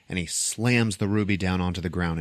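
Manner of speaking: ordinary talk, nothing marked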